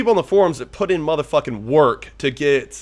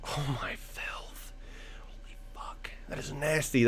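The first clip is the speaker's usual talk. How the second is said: hushed, breathless whisper of awe; awed whisper; 17 dB quieter, slower than usual. Real, not acted. Gamer whispering in awe at an opponent's nasty card. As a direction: breathe it out in disbelief.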